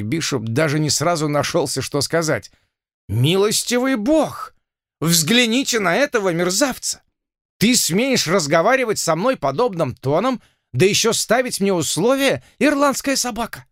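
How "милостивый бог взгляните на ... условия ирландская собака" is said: outraged colonel. Acted. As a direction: rage at the insolent doctor. (возмущённый полковник)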